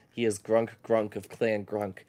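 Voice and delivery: ordinary talk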